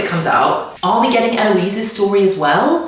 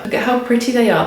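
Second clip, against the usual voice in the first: normal talking voice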